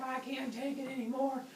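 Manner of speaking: high-pitched theatrical whine; mock agony. Zeus complains of his pounding headache, high mock-desperate voice. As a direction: play someone at the end of their rope.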